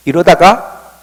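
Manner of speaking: ordinary delivery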